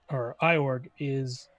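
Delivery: ordinary talk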